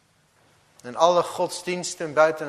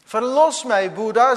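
pleading man; 9 semitones higher, 10 dB louder than usual. Acted. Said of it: "zei de man".

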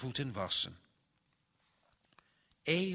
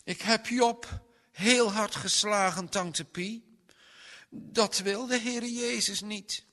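put-on high, a touch tearful child's voice; lightly voiced upset little boy; tearful confession; 9 semitones higher, 5 dB louder than usual. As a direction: confess it with a lump in the throat. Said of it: "vulden die bruine kinderogen zich met tranen".

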